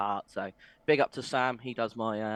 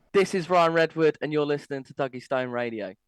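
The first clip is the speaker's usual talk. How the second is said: punchy radio station ident; radio drop; 4 semitones higher, 5 dB louder than usual. Shown as a demonstration. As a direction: announce it like a jingle. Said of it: "if you can do us a".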